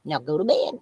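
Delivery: lightly voiced mother scolding, laughing; a hint of a stern mum. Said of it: "I'll be like [name]".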